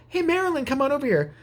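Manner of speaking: high-pitched salesman hollering for a colleague; put-on cheery summons; calling out. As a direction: call someone over in a high singsong. Salesman calls a colleague over.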